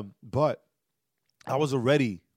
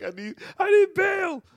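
very high, loud, drawn-out cry for help; hammed-up high-pitched begging; mock desperate plea; 8 dB louder than usual. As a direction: wail it comically from a jail cell. Joking plea from jail.

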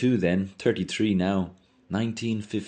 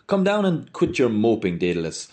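calling out; 5 semitones higher, 4 dB louder than usual. Acted. Mulligan calls from stairwell.

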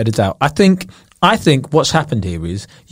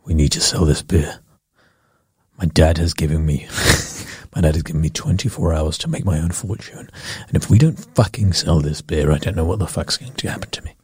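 deep panicked executive; low, desperate boss rant; 11 semitones lower, 7 dB quieter than usual. Pretend. Boardroom boss voiced.

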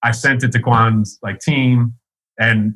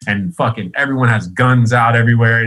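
usual speaking voice

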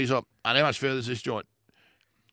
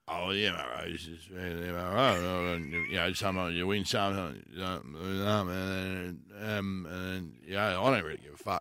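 mumbling Swanee impression; 8 semitones lower, 4 dB quieter than usual. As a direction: mumble it like you couldn't care less. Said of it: "question for you, Swanee".

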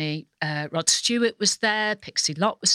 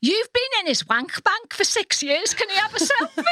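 cheeky fan; 3 dB louder than usual. Retold or imitated. Woman asking Carol for a selfie for her friend, high and cheeky. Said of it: "she said".

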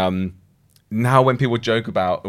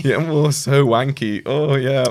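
higher, drawn-out fake going-along; mock agreement; 6 semitones higher, 4 dB louder, slower than usual. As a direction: play along with exaggerated, slow sympathy. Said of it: "And I was like".

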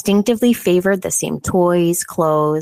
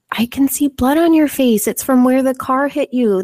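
put-on eerie child voice; 7 semitones higher than usual. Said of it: "and Jillian says".

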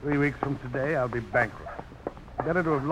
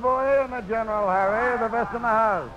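hearty call; 9 semitones higher, 4 dB louder than usual. Acted. Host hails guests in a saloon.

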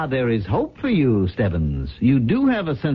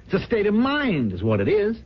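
high, impassioned brush-off of quality; scornful zeal; 9 semitones higher, 3 dB quieter than usual. Acted. Boss lectures his manager on selling, high and fervent. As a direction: sell the cynical idea with rising fervor.

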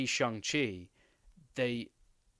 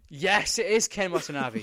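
loud, raised burst of relief; excited confirmation, higher and louder; delighted recognition; 6 semitones higher, 8 dB louder than usual. Real, not acted. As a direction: exclaim it, thrilled to finally have the name. Name finally found after forgetting it.

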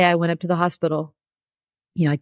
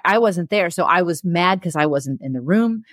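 ordinary talk, nothing marked

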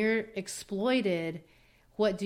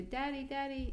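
put-on higher, soft child calling Daddy; innocent child; 7 semitones higher, 5 dB quieter than usual. Boy looks up at his father, soft and innocent. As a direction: call out sweetly like a little boy.

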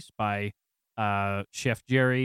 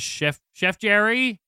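raised, drawn-out call to the kitchen; 8 semitones higher, 6 dB louder, slower than usual. Acted. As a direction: call higher and louder, slowly. Calls into the kitchen.

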